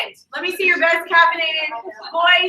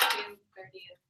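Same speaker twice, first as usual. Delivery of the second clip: ordinary delivery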